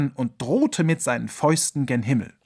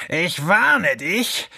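slow, higher, louder warning snarl; hissing threat; 4 semitones higher, 4 dB louder, much slower than usual. Acted. Fox threatening the ringing alarm clock, snarling. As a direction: spit out the warning slowly. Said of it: "fauchte der Fuchs".

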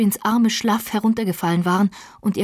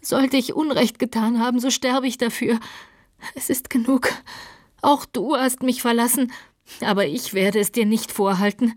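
raised, lightly sobbing voice; tearful pleading; 5 semitones higher than usual. A narrator's reading. Catherine asks Heathcliff to forgive her, tearfully pleading. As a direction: plead for forgiveness in a higher, weakened voice. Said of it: "schluchzte Catherine".

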